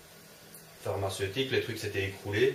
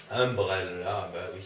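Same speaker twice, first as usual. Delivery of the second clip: while sipping a drink; 3 semitones lower, slower than usual. Real, not acted. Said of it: "Désolé, je bois comme un petit cochon".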